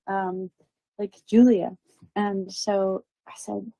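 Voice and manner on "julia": normal talking voice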